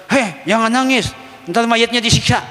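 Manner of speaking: mock scolding relative. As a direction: imitate someone hushing a mourner. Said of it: "jangan orang lagi ngelampiasin".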